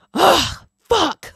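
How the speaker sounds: imitating a frustrated scientist; hammed-up annoyed ugh; upset groan. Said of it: "they're so upset about this".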